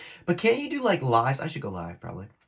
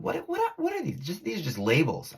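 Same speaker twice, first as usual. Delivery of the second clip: squeaky, much higher what what; mock bewilderment; 6 semitones higher than usual. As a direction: play baffled innocent.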